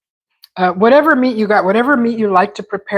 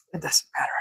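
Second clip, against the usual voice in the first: breathy whisper to self; 13 dB quieter, much faster than usual. Real, not acted. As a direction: whisper it under your breath. Repeated, first whispered, then plain.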